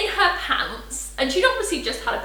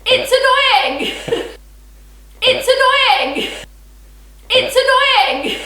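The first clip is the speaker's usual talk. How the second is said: It's annoying, slow, high and loud; emphatic complaint; 10 semitones higher, 7 dB louder, much slower than usual. Real, not acted. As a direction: drag out "annoying" in exasperation. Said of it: "repeated for emphasis".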